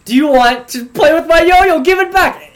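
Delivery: loud pleading outrage, climbing high; exasperated demand. Retold or imitated. Boy to classmate holding his yo-yo, exasperated demand. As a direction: build from repeated question to high, loud demand.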